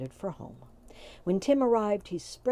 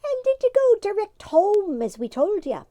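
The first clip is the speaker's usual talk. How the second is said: mocking fairy; 13 semitones higher, 9 dB louder than usual. A narrator's reading. The leader teasing an angry Tim. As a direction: needle him with a grin.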